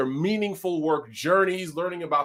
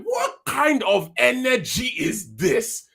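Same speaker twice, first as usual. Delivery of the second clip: put-on Nigerian accent; 8 semitones higher than usual. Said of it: "my Nigerian accent comes out".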